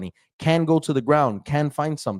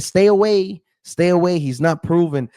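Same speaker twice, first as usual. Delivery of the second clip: emphatic warning; 7 semitones higher, 5 dB louder than usual. Retold or imitated. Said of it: "I said".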